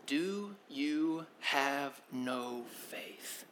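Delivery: very slow, soft, tender asking; gentle question. Shown as a demonstration. Preacher asking the listeners Jesus's question, softly. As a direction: let it land softly and slowly. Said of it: "repeats Jesus's question".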